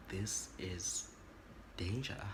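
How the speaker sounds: slow breathless whisper of realization; inner whisper. Retold or imitated. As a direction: whisper it to yourself as it clicks. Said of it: "Now I'm lowering my voice".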